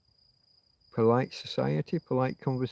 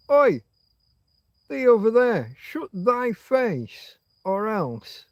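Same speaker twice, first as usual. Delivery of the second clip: much higher and louder oi, slowing into a threat; Yorkshire shout; 8 semitones higher, 3 dB louder, slower than usual. Retold or imitated. Childhood Yorkshire kid shouting at another, loud dialect threat. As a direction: yell it across the playground in broad Yorkshire. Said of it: "Best example I can give from childhood".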